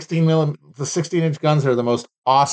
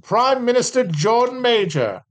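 higher, slow, hammed-up British announcement; mock British accent; 8 semitones higher, slower than usual. Reading the head of government in a mock British accent. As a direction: announce it like a proud Brit. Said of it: "that turned Australian there".

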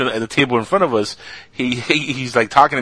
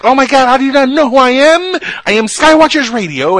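high, loud diva tantrum, much higher and louder; mimicking the co-host's diva meltdown, high and loud; mock diva; 8 dB louder than usual. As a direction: ham up a spoiled star demanding recognition. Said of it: "completely diva'd out".

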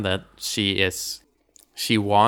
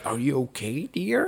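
senile dad; 3 semitones higher, 7 dB quieter than usual. Retold or imitated. Dad just hit by a heel, senile voice. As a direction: imitate a dotty old father's gentle question. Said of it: "Wow, he's senile".

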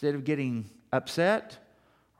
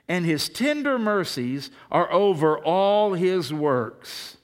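scripture reading; 2 semitones higher, 5 dB louder, slower than usual. Real, not acted.

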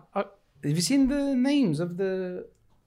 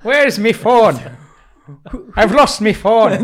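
loud, higher hammed-up British dialect; blaring cartoon British "me phone" voice; mock British dialect impression; 3 semitones higher, 9 dB louder than usual. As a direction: parody her impression of your British accent. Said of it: "That is your impression of me".

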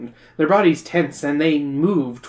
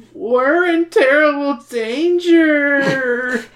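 slow, high surfer drawl; Pauly Shore voice; 10 semitones higher, 3 dB louder, much slower than usual. Acted. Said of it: "Just become Polly Shore slowly".

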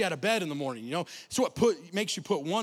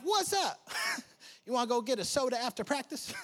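high, soft innocent voice; naive kid; 5 semitones higher, 4 dB quieter than usual. Retold or imitated. Boy naively inviting the bully for soda, high and innocent. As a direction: play it sweetly oblivious.

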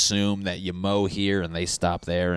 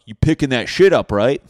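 loud, higher call-out; yelling neighbor; 6 semitones higher, 12 dB louder than usual. Acted. Neighbor to a dog walker, loud demanding call.

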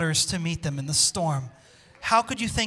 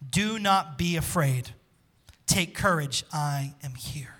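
gentle Jesus voice; 5 semitones lower, slower than usual. Acted. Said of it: "And Jesus spoke".

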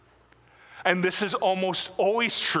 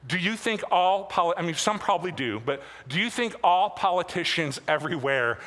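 ordinary delivery

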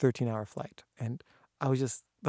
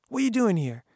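much higher and louder, challenging; angry demand; 10 semitones higher, 7 dB louder than usual. Retold or imitated. Gardener confronting kids on his property, loud angry demand. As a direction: confront the intruder hard.

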